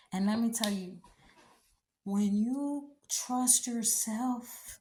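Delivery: normal talking voice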